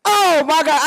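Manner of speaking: loud, high gasp of joy; amazed yell at the picture; excited yelling. Real, not acted. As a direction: shout it out thrilled. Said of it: "I'm yelling and screaming".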